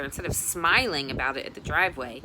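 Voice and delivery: plain reading voice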